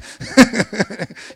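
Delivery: a big loud laugh at his own joke; real hearty laugh; loud burst of laughter. Real, not acted. (大声で笑う)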